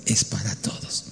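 dice la frase mucho más suave; cita en voz baja. Retold or imitated. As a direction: cita las palabras bajito, con recogimiento. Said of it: "unas palabras que ahorita le voy a decir". (citando la frase en voz baja)

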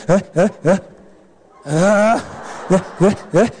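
imitated hiccuping sobs, low and rhythmic; mock a kid catching breath after bawling; crying. Spanked child sobs on, low mock hiccups. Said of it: "¿Cómo te quedas llorando?".

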